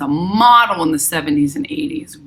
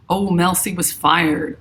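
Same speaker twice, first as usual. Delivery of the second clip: usual speaking voice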